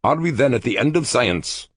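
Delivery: lightly voiced scholar crying out; a touch of despair; cried out. A narrator's reading. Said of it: "Cultan cried once".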